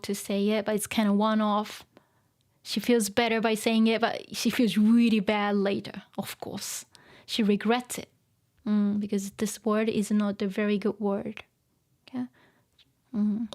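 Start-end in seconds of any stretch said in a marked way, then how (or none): none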